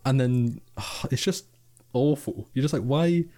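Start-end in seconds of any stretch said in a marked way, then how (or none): 2.8-3.2 baffled why. Retold or imitated. Mock reaction to friendly staff.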